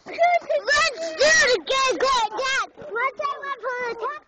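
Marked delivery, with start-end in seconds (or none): 0.1-2.7 excited shout. Real, not acted. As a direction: beg loudly to go again. Kid begging for another run.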